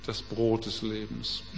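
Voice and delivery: ich bin das Brot des Lebens, deutlich leiser und tiefer; hushed solemn claim. Acted. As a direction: leise und feierlich wie ein Bekenntnis. Jesus' claim read quietly. (eine Spur leiser, ehrfürchtiger Jesus)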